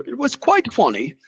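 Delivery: hammed-up British accent, pitched high; exaggerated mock-British lilt, well up in pitch; bad British accent. Shown as a demonstration. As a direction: put on a horrible fake British accent. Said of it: "that was a horrible accent".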